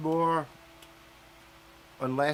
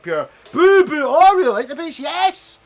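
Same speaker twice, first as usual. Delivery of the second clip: mock delight; 11 semitones higher, 12 dB louder than usual. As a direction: ham up a gushing convert. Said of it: "then you're like".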